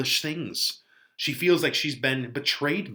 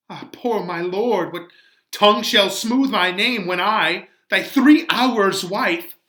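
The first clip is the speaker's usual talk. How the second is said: anguished Juliet; 8 semitones higher, 8 dB louder than usual. Acted. Juliet torn, raised voice.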